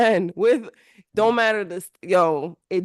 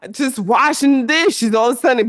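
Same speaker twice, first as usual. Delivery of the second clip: usual speaking voice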